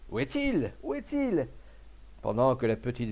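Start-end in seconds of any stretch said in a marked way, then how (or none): 0.1-1.5 high-pitched urgent calling; agitated, voice leaping very high; crowd's cry. A narrator's reading. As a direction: call out like a mob on the hunt. Armed crowd hunting the dog.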